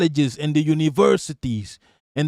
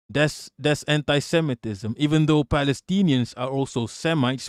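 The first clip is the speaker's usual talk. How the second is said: ordinary delivery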